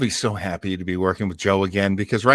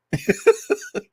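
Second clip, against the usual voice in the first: high breathy laugh; 3 dB quieter than usual. Real, not acted. Host laughs at a listener's joke.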